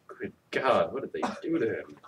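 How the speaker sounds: usual speaking voice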